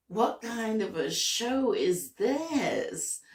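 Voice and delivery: high, slow baffled question; bewildered. Retold or imitated. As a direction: stretch it out, completely baffled. Confused in the show.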